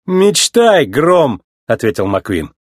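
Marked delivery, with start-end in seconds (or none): 0.1-1.4 cocky retort. A narrator's reading. Cocky comeback to the rival.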